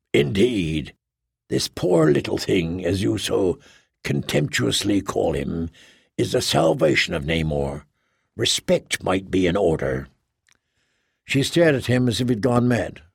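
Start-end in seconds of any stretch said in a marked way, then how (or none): 0.1-10.1 lower, measured and pompous, slowing at the end; a solemn lecturer on cats, lower; Tuff's voice. A narrator's reading. Tuff rebukes Kay.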